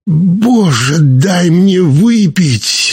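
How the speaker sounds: high, drawn-out desperate plea; exhaled plea. A narrator's reading. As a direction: draw the words out high, begging for a drink. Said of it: "выдохнул я".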